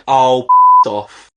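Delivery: slow, fed-up swear; grumbled swear. Acted. Fan to the United delivery, fed-up slow swear. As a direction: drag out a disgusted brush-off.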